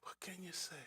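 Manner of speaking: whispered question, almost breath; soft unvoiced repeat; whispered echo. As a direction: let it drop to a hush.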